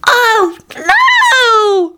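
very high, drawn-out wail of dismay; horrified cry. Acted. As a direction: cry out in shock at the threat. Listener gasps at beheading threat.

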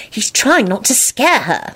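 slow, high, firm assertion. A narrator's reading. Solange defending Lupin to Quentin, firm and deliberate. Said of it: "Solange asserted firmly".

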